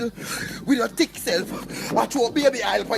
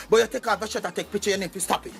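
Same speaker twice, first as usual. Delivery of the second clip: ordinary delivery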